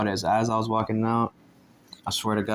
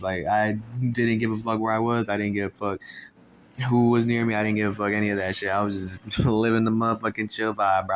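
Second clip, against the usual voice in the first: normal talking voice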